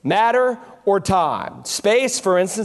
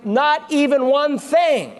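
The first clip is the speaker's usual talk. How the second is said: "not even one thing" raised high and drawn out; emphatic; 4 semitones higher, slower than usual. Shown as a demonstration. Preacher reading out the literal Greek, high slow emphasis. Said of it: "the Greek text is more emphatic".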